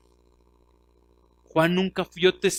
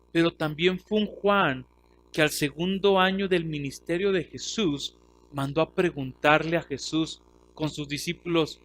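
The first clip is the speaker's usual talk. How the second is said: normal talking voice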